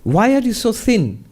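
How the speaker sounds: guru's concerned high question. Retold or imitated. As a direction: ask the snake why it is so thin, with concern. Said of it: "he says to the snake".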